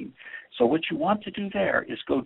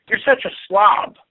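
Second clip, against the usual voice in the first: angry accusation; 4 semitones higher, 7 dB louder than usual. Shown as a demonstration. Demo of angry criticism.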